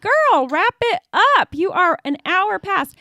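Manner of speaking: stern mom. Retold or imitated. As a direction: tell her to wrap it up. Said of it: "And I was like".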